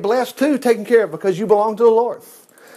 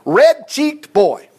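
slow, higher, mocking contempt; sneering; 6 semitones higher, 4 dB louder, much slower than usual. A narrator's reading. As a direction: drag it out with scorn. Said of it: "sneering in contempt".

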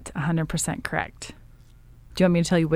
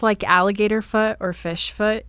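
ordinary delivery

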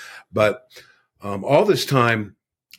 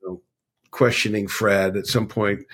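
usual speaking voice

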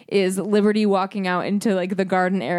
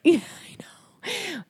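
whispering; 9 semitones higher, 3 dB quieter, much slower than usual. Real, not acted. Whispered aside.

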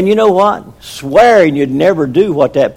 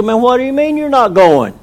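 indignant wife; 8 semitones higher than usual. Retold or imitated.

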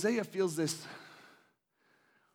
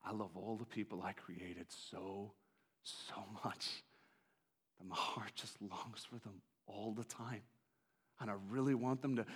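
much lower, hushed and tender; God's tender consolation; 7 semitones lower, 9 dB quieter than usual. Acted. God comforts Hosea with an arm around him, hushed and tender. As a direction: comfort a grieving son. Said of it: "God's got his arm around him and says".

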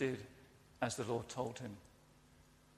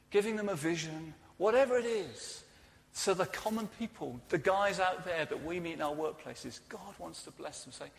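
normal talking voice

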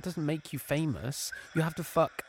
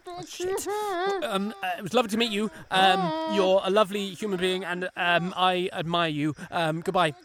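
fast, high nervous gabble; flustered babble; 6 semitones higher than usual. Acted. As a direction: rush out the goodbye. Caught, babbling exit.

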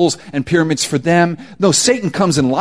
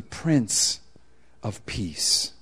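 hushed reverence; 8 semitones lower, 11 dB quieter, much slower than usual. Acted. Reverent slowing on the title.

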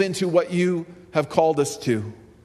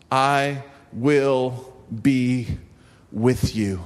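very slow, dropping low on the last words; slow scripture reading; 3 semitones lower, much slower than usual. Acted. Pastor reading God's promise to Israel, slow and hushed. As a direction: stretch each word out, let it sink in.

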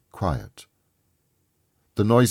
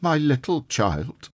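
slow, high-pitched father's lament; breaking down; 8 semitones higher than usual. A narrator's reading. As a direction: draw it out high, grieving for a child. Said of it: "He broke down all at once".

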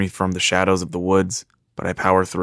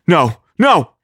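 loud, rising cries of panic; desperate shout; 10 semitones higher, 7 dB louder than usual. Acted. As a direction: scream it as the book slips away. Book flies from his hands.

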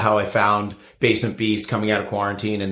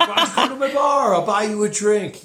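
feigned nonchalance; 10 semitones higher than usual. Retold or imitated. Feigning cool to his idol.

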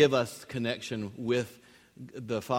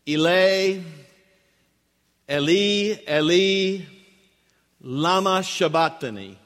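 raised, drawn-out scriptural cry; loud crying out; 3 semitones higher, 5 dB louder than usual. Acted. Preacher quotes Jesus on the cross, crying out loudly. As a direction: cry it out loud, each word held. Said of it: "Jesus cried out in a loud voice".

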